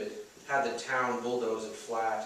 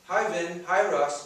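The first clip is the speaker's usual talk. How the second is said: put-on bright girl greeting; 7 semitones higher, 6 dB louder than usual. Maeve greets boys.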